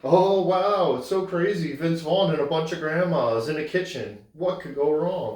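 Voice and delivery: high, loud fake amazement; hammed-up wide-eyed trailer voice; mock movie trailer. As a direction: gush like a cheesy film promo. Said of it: "like, the trailer".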